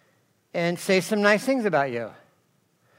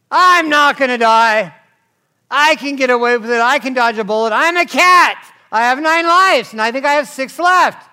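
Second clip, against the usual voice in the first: a hint of a cocky young guy, louder; mock reckless teen; 7 semitones higher, 10 dB louder than usual. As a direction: lightly voice a cocky kid who thinks he can't die. Voicing a reckless young person.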